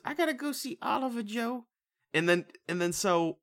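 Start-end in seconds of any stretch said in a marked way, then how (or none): 0.0-1.6 much higher, fast, softer voice; mocking Emily. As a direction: rush it out high and fragile. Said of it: "And so she goes".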